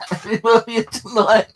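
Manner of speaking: words breaking up into high, loud laughter; real laugh bubbling through the words; can't hold the laugh in; laughing while talking. Real, not acted. Laughing at own earlier teasing.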